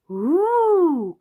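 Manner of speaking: high, loud, drawn-out ooh; excited gasp. A narrator's reading. Peppa and George hear of a surprise adventure, excited gasp. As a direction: let the awe swoop up high and loud. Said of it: "gasped Peppa and George".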